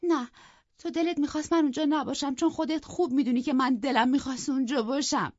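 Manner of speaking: high, loud, fast tearful protest. Acted. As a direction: plead through tears, rushing the words. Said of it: "گریه کنان گفت".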